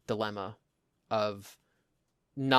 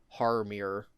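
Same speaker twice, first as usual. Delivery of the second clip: "Horror mirror" as "har meer" in a Jersey accent; New Jersey accent; 4 semitones lower than usual. Shown as a demonstration. Demonstrating our accent, Jersey "Horror mirror" as "har meer". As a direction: give it Jersey vowels, two short syllables. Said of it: "So that's our accent".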